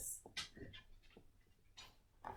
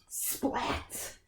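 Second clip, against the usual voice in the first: ordinary delivery, nothing marked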